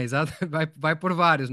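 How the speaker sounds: usual speaking voice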